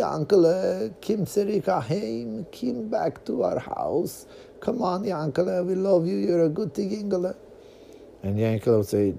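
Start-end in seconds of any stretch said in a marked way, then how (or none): 0.0-7.3 put-on high pleading Yiddish-accented parent. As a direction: coax him home in a Yiddish accent.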